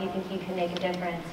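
usual speaking voice

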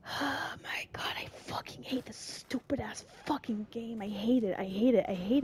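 whispered cursing under the breath; whispering angrily at the game; frustrated whisper. Real, not acted. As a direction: hiss your hatred of the game in a whisper. Whispering curses at scary game.

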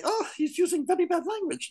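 high, falsetto imitation of little girls; little girls' voice. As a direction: squeak it like a shocked little girl. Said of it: "The little Japanese girls say".

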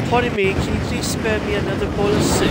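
high, prim mock-polite plea; posh begging orphan. Mocking his little brother as a polite Oliver Twist orphan. Mimicking kid begging for soup.

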